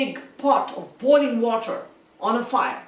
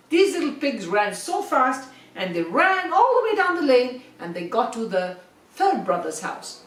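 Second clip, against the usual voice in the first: ordinary delivery, nothing marked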